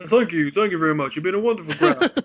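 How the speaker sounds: hammed-up crooner thanking the crowd. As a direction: do a playful impression of the King on stage. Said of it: "my best [name] impression".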